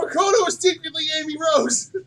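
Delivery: very high, loud squeal; ecstatic shriek. Real, not acted. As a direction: shout the reveal in disbelief. Shocked fan reaction to twist.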